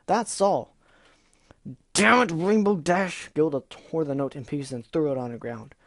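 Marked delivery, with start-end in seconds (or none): 0.1-0.8 defensive shout. Acted. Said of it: "the Pegasus shouted". 1.9-3.3 loud, high, slow and dragged-out angry cry; furious roar. Acted. Gilda explodes in anger.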